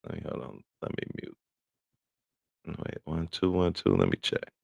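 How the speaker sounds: ordinary delivery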